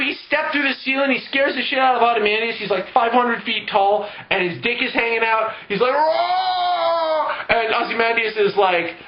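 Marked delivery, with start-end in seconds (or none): 5.9-7.3 long, high, held roar; mock giant roar. Giant Doctor Manhattan scares Ozymandias, mock roar. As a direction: roar it out in one sustained hammed-up cry.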